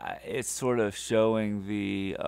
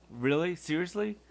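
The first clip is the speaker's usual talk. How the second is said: incredulous; 8 semitones higher than usual. Retold or imitated. Incredulous retort.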